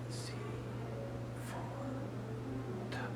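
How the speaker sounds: soft hearing-test whisper. Real, not acted. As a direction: whisper the letters behind the ear. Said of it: "I'm gonna whisper three random, uh, numbers or letters".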